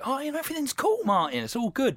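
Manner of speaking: high chirpy everything's-fine voice; breezy placating. Retold or imitated. Placating Martin in imagined scene.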